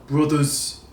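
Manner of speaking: one word in a mock Game of Thrones accent. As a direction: try the Westeros accent and miss a little. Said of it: "Oh, I can't do that accent".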